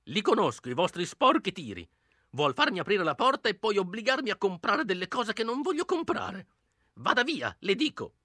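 put-on high, suspicious old woman; old woman scolding. Old woman refuses to open for salesmen, scolding. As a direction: she won't be fooled by salesmen. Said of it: "gridò lei".

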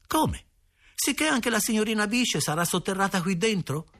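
put-on high-pitched, incredulous child's question; astonished boy. A narrator's reading. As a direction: ask in high, amazed disbelief. Boy astonished by father's answer. (stupore incredulo, voce acuta)

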